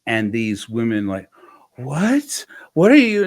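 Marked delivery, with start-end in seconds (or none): 1.8-3.2 high, loud incredulous "what are you?"; shocked disbelief. Retold or imitated. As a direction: gasp out a scandalized question. Women shocked by therapist's question.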